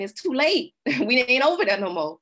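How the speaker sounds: high, loud mock protest with a laugh; imitated protest. Protesters answering the government's late apology, imitated protest. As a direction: call it out like a fed-up protester. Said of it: "people coming back and protesting saying".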